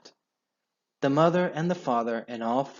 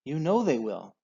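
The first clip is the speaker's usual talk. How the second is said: tearful; 3 semitones higher than usual. Acted. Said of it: "sobbed Missus Fox".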